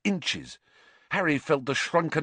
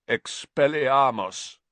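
slow, rasping croak of a spell; 2 semitones higher, 3 dB louder, slower than usual. A narrator's reading. Escaped prisoner disarming the children, slow croak. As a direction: croak it out like a long-unused voice. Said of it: "he croaked".

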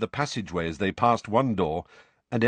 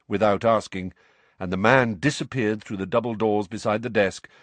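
ordinary delivery, nothing marked

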